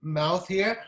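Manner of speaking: usual speaking voice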